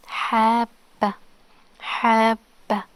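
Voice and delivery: "habba" slowly, the b held before release; doubled b. Shown as a demonstration. Teacher repeating the shadda word, "habba" with doubled b. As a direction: split "habba" into hab and ba, pausing on the b. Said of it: "The ba is doubled".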